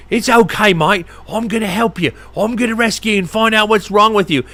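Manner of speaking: soothing wildlife-host Australian; a hint of Australian croc-hunter; Steve Irwin impression. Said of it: "thinking I'm Steve Irwin or something".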